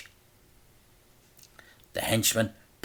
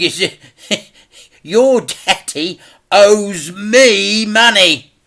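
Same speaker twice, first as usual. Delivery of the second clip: laughing, then slow and loud; gloating laugh; 11 semitones higher, 11 dB louder, slower than usual. A narrator's reading. Mister Big gloats to the boy, laughing. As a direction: savour every word with a chuckle.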